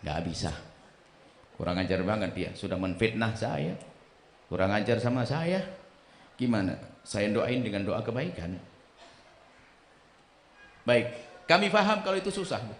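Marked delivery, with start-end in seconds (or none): 0.0-8.6 very low, soft grumbling complaint; complaining listener. Acted. A congregant complaining that praying for enemies is too hard, low and grumbling. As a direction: moan it under your breath. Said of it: "Ada yang berkata".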